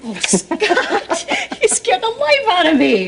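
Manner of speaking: startled laugh, high and breathless; laughing off fear. Acted. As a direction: laugh off the fright.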